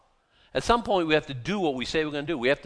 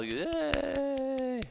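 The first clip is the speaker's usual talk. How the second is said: high, softly held cheer; drawn-out, high-pitched pretend hooray; mock cheer; 12 semitones higher, 5 dB quieter than usual. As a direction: imitate a crowd with a long thin yay. Imitating congregation cheering the giver.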